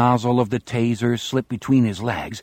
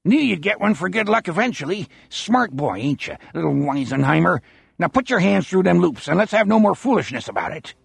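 put-on higher, fast gloating old captor; Bernie, gloating old man; 6 semitones higher, faster than usual. A narrator's reading. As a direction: taunt him like a wheezing old man. Bernie's voiced lines.

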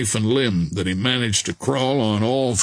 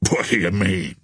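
frowning question; 4 semitones lower than usual. A narrator's reading. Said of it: "asked the other with a frown".

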